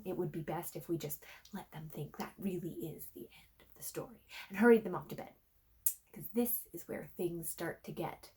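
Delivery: put-on hushed, quick whisper so the kids won't hear; playful grown-ups-only whisper; conspiratorial whisper. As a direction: lean in and whisper the secret fast. Aside about small children listening.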